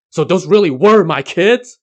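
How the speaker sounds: a demon fuming at the news. A narrator's reading. Yellow Robe fuming at his wife. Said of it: "Yellow Robe fumed".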